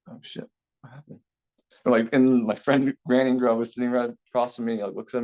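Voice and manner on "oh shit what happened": breathless whispered "oh shit"; whispered panic. Retold or imitated. Student whispering in shock after teacher calls him out, hushed whisper. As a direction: whisper it in sudden dread. Panicked reaction to being called out.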